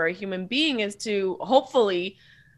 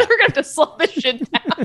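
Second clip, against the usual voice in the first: loud, high words through laughter; real laughter; 5 semitones higher, 5 dB louder than usual. Real, not acted. Cracks up at talking too fast.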